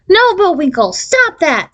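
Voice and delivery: shrieking. A narrator's reading. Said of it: "Stephanie shrieked".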